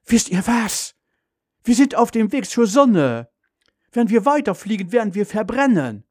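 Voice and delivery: high-pitched, urgent mother's warning; very agitated. A narrator's reading. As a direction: alert the family in alarm. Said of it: "Mutter sagt ganz aufgeregt". (ganz aufgeregt, mit hoher Stimme)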